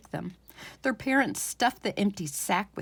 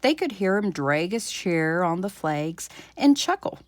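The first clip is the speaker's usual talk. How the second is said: ordinary delivery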